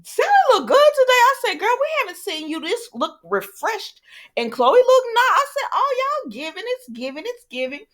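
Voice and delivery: high-pitched, hyped-up compliments; excited gushing. Real, not acted. Vlogger praising Sally and Chloe's looks, excited gushing. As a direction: gush in a high, delighted voice.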